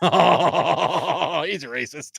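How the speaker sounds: laughs through a joking accusation; bursting out laughing mid-line. Real, not acted. Laughing joke about the number.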